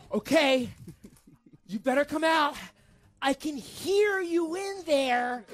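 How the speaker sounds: high, loud calls through a door; slow, drawn-out imitated threat; menacing mock holler. Girlfriend pounding on the door, menacing mock holler.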